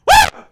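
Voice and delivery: high shouted woo; Ric Flair woo. Pretend. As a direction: whoop like a wrestler. Wrestler's victory cry.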